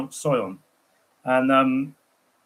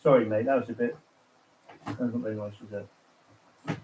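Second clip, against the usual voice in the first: much softer, trailing guilty aside; real softer apology to his mate; sheepish apology; 4 semitones lower, 6 dB quieter than usual. Real, not acted. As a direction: murmur it, a little ashamed. Apologising for a joke.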